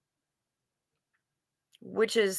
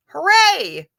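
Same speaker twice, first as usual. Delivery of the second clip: cheer; 8 semitones higher, 8 dB louder than usual. Real, not acted. Cheering that the internet works again.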